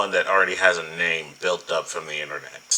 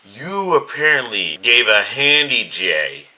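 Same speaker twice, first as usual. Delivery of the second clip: mocking; 5 semitones higher, 6 dB louder, slower than usual. Real, not acted. Mocking the accusation.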